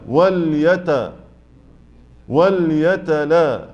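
very slow syllable by syllable; split into syllables. Shown as a demonstration. Teacher dividing a hard word slowly. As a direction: break the word apart piece by piece. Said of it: "شايف قسمتها".

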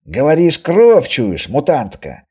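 triumphant accuser. Acted. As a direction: pounce on her as if exposing her. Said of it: "с видом разоблачителя произнёс Костоправ". (громко, тоном разоблачителя)

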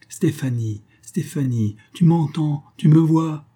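appel exalté. A narrator's reading. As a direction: cry out, rising sharply on the questions. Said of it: "et il s'écriait". (s'écrier, de plus en plus haut et fort)

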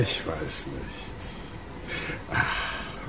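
langsam, stimmlos, viel leiser; zögernd hauchen; geflüstert. Acted. Krug zögert flüsternd. (Krug zögert im Flüsterton)

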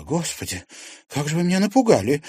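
high, fast shaken exclamation; nervous. Acted. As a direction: blurt it out after a fright. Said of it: "нервно призналась она".

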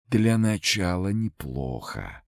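slow, otherworldly murmur; twilight ghost voice. A narrator's reading. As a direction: speak like a dusky phantom. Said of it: "знакомый сумеречный голос". (сумеречный потусторонний голос)

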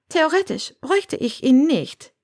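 high woman's voice, mildly reproachful; a bit reproachful. A narrator's reading. Granger explains she doesn't strictly need it, a bit reproachful. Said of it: "klang ein bisschen vorwurfsvoll".

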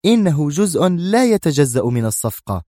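a touch of Mona's firmness; firm. A narrator's reading. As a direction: insist it is non-negotiable. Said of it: "قالت في حزم".

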